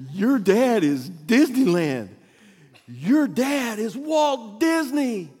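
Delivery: loud, high, slow mock proclamation; emphatic teacher revelation. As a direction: drum it in, stretching every word. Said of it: "the teacher looks at her and says".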